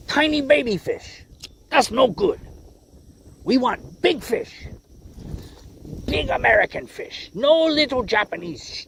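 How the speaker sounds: high, hammed-up mock Japanese accent. Angler impersonating a Japanese viewer mocking his small fish, mock Japanese accent. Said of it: "He's like".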